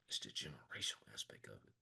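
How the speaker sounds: breathy whispered aside. Real, not acted. Coach to his call group, a sudden whisper. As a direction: drop to a whisper to stress the point.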